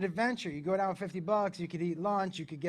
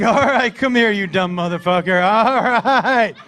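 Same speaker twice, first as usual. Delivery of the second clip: loud, mocking exasperation; exasperated dad; 2 semitones higher, 12 dB louder than usual. Dad to son who claims he speaks Spanish, loudly exasperated. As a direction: call him over loudly, drawing out the all-rights.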